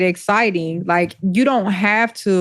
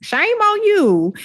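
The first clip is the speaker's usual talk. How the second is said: mock scolding; 6 semitones higher than usual. Podcast host chides couples who stir up drama, mock scolding. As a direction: scold them playfully, voice swooping up high.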